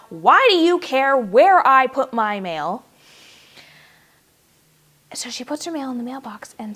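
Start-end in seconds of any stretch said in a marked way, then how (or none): 0.1-2.8 slow, loud exasperated shout at the sky; yelling at God. Retold or imitated. She yells at God about the mail, loud and slow. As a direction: hurl the question upward, fed up. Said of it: "yelling at God".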